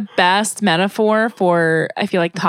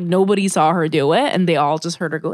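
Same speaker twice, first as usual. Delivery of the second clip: ordinary delivery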